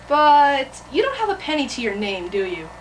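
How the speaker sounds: usual speaking voice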